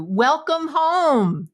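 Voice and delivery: slow, drawn-out high welcome; warm welcome. Shown as a demonstration. Greeting husband at the door, slow and loving. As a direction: stretch it out lovingly. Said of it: "instead to say what you really mean".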